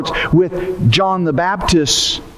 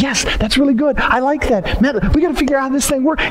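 high, eager imitation of the fans; mock eager crowd; 7 semitones higher, faster than usual. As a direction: play the crowd gushing over John. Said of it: "and you were like".

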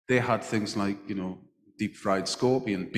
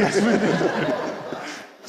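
laughing answer; 7 semitones higher, 4 dB louder, much slower than usual. Real, not acted. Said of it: "I'll be the judge of that".

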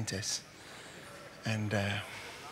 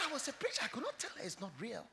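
much softer, quick sorrowful answer; hushed reply; 7 semitones higher, 10 dB quieter, faster than usual. Retold or imitated. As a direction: quietly admit the hard truth. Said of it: "And he said".

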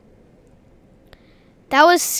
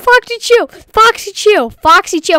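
yelling; 5 semitones higher, 5 dB louder than usual. Real, not acted. Still yelling at Foxy.

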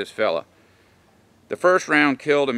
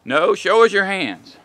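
higher, slow, insistent cop command; repeated cop command; 7 semitones higher, 4 dB louder than usual. Retold or imitated. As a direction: say "show us your hands" again, louder and slower, losing patience. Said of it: "where cops are like".

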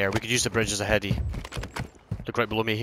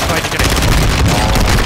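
shouting; 10 dB louder, much slower than usual. Real, not acted. Urgent callout in firefight.

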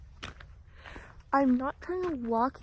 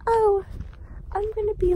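much higher, bright mock-eager voice; mock cheerful; 11 semitones higher, 5 dB louder than usual. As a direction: play the keen exercise girl. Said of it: "today I was like".

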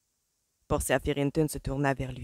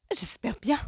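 tart retort; 7 semitones higher than usual. A narrator's reading. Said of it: "rétorqua sa mère".

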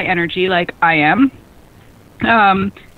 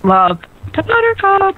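sing-song echo; 10 semitones higher than usual. Pretend. Echoing sing-song farewell to Buttercup.

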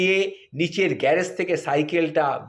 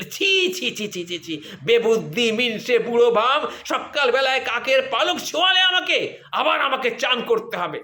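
high shrieking chi chi, then higher scolding; hammed-up disgusted wife voice; 5 semitones higher than usual. Wife scolds husband for touching crow feather, higher disgusted shriek.

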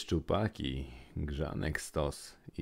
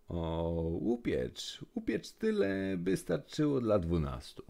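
much higher, pleading crowd voice; mock whiny plea, rising high; begging villagers; 6 semitones higher than usual. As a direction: wheedle like greedy villagers at the door. Villagers beg the troll.

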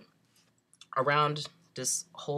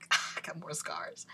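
whispered aside; 7 dB quieter than usual. Retold or imitated. Telling the nurse about new scars, whispered. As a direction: hush it like a rueful aside.

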